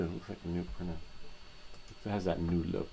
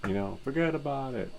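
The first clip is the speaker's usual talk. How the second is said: a quick mock New Yorker, higher and louder; New York accent; 7 semitones higher, 5 dB louder than usual. Joking about YouTube bans, mock New York accent. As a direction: toss it off like a Brooklyn guy. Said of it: "they speak like a New Yorker".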